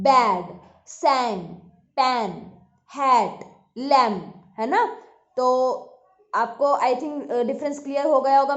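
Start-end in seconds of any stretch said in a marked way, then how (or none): 0.0-4.3 each word louder, the vowel short and open; बैड सैंग पैन हैट लैम्प with the open a of "bad"; short a vowel demo. Shown as a demonstration. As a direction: model the words clearly for the class. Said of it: "अ का sound".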